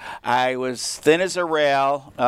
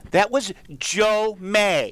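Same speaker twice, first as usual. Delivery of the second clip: slow, higher punchline with JOE MAY drawn out; dramatic reveal; 6 semitones higher, much slower than usual. Real, not acted. Storyteller revealing to Angela who the player was, dramatic. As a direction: land "Joe May" slowly and big.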